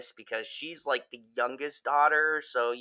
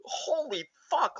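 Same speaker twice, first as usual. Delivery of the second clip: shocked; 12 semitones higher, slower than usual. Real, not acted. Shocked reaction.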